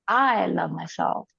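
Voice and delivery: long, swelling "I" at the start; slow affirmation with all the weight on "I"; stress on "I". Shown as a demonstration. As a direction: stretch out "I", then ease through "love myself". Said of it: "put emphasis on different words".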